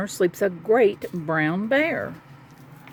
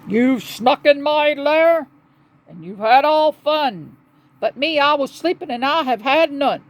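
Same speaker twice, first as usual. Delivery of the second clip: loud, higher-pitched aggrieved bear; wailing bear; 6 semitones higher, 5 dB louder than usual. A narrator's reading. Woken bear protesting he missed the party, hammed-up wail. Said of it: "he whimpers and he moans and he wails".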